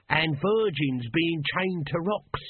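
Nobby's sly, knowing contribution. A narrator's reading. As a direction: draw it out like someone in the know. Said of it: "said Nobby, knowingly".

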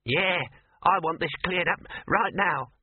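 high, slow, insistent mouse; squeaky mouse. A narrator's reading. Mouse demands an explanation, high squeaky voice. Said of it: "squeaked the mouse".

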